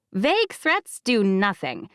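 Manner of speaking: ordinary delivery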